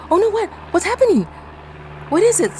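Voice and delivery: high, loud panicked questions. Retold or imitated. Girl on ski slope, frightened, asking what's coming. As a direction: gasp out your confusion in fright. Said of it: "gasps I'm like".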